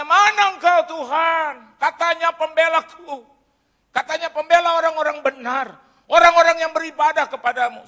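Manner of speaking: high, very loud accusing outcry; shouting at God. Retold or imitated. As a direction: hurl the accusation up at heaven.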